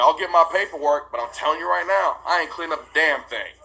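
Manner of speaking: retold defiance. Answering the judge about cleaning up, retold defiance. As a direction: stand your ground in a retold argument.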